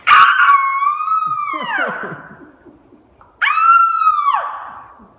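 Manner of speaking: long, piercing high scream; terrified ride scream. Acted. Mother acting out the ride's launch, terrified scream. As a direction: scream full force, twice.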